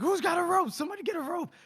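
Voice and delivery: very high-pitched mock panic; urgent calling out. Leader calling men as a soldier sinks, urgent calling out. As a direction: cry for help in a squeaky, frantic voice. Leader calls for rescue rope.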